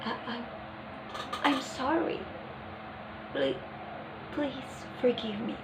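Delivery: soft, halting demo of an embarrassed apology; embarrassed stutter. Shown as a demonstration. Teacher showing how an embarrassed person apologizes, soft embarrassed stutter. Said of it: "embarrassed may stutter in a low pitch".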